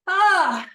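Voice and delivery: loud high effort cry; reaching ah. Shown as a demonstration. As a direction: shout it as you stretch up. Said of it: "say you're reaching for something tall, you go".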